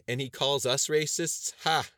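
mock the accusation with a barking laugh; scornful laugh. A narrator's reading. Brighton scoffs at the article.

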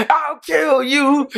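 very high, slow roaring threat; roaring tyrant king. Retold or imitated. Imitating Akainu threatening Fujitora.

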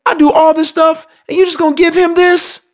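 very high indignant complaint; whiny angry brother. Retold or imitated. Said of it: "he's pissed off because he's like".

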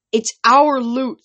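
put-on a small guy yelling up at a taller girl; yelled. A narrator's reading. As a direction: bellow it up into her face. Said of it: "he yelled".

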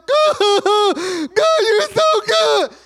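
very high, loud, drawn-out wail; hammed-up weeping scream of praise; screaming sob to God. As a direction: cry out to God at full volume. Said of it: "I gotta be screaming at this point".

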